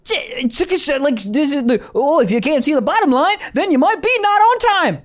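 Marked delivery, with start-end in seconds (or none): 1.9-5.0 mock TikTok voice. Mocking the doctor's TikTok rhyme.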